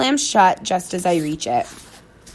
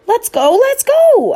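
much higher and louder, building; puppy begging to play; excited bark; 8 dB louder, slower than usual. A narrator's reading. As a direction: yip it out, can't wait. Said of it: "I bark".

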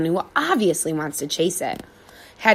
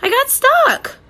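panicked dog; 5 dB louder, slower than usual. A narrator's reading. Dog stuck in fence.

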